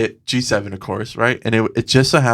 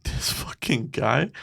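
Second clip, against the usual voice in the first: muttered; 9 dB quieter, slower than usual. Retold or imitated. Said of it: "And I was like".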